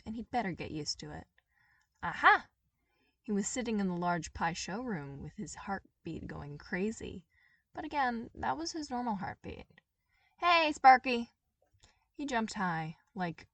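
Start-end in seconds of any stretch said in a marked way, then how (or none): 2.0-2.5 loud, high gotcha; sudden triumphant exclamation; triumphant aha. A narrator's reading. Toph finds Sparky by sensing. 10.4-11.3 loud, high shout; brash loud greeting; calling out. Acted. Toph calls out to startle him.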